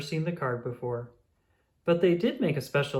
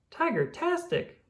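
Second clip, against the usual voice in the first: delighted exclamation; 13 semitones higher than usual. Acted.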